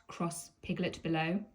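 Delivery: ordinary delivery, nothing marked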